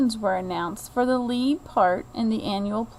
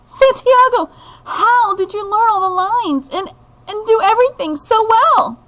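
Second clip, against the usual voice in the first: high, loud, amazed father; proud father; 10 semitones higher, 8 dB louder than usual. Acted. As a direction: burst in full of pride. Proud father rushes backstage.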